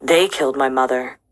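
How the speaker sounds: a hint of a frightened boy. A narrator's reading. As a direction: drop low for the boy's distressed account. Client's words read lower.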